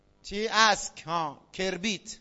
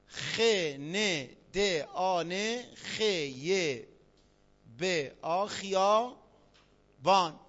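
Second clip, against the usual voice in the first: letter-by-letter spelling; much slower than usual. Shown as a demonstration. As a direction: sound out each letter of خیابان one by one. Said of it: "گاهی اوقات کلمات مشابه رو حدس".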